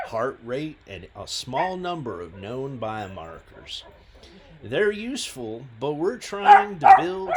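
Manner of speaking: loud put-on southern accent; reply in an amateur southern accent; a rough attempt at southern US speech; bad southern accent. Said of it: "by replying in a southern accent. Probably a bad one".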